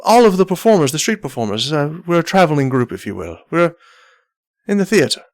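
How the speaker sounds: lightly voiced showman captain, lilting; a hint of a grand captain presenting his troupe; courtly captain. Captain explaining his traveling group, lightly voiced captain.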